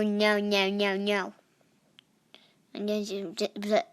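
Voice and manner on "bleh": normal talking voice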